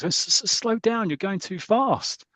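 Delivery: high, slow, stammering fright; stuttering passenger, high and slow with fear; panicked plea. Acted. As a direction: beg him to slow down, scared stiff. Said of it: "very dramatic".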